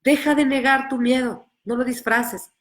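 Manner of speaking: ordinary talk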